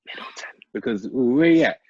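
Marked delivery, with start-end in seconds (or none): 0.1-0.5 whispered name; hushed, as if not to be overheard; whispering. Real, not acted. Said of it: "I'm whispering it".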